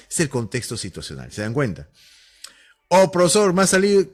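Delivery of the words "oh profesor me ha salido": excited student. Retold or imitated. Student reacting in class.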